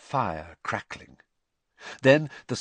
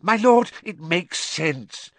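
high, eager servant; grateful, relieved agreement; 8 semitones higher, 5 dB louder than usual. A narrator's reading. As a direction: burst out loud, then ease off. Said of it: "sounding thoroughly relieved now".

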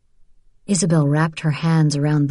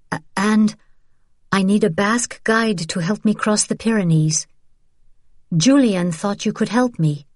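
higher-pitched, earnest plea for help; Isabel, hesitant request; 4 semitones higher than usual. Acted. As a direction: stumble at first, then ask earnestly.

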